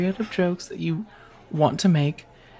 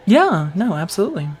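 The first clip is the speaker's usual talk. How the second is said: usual speaking voice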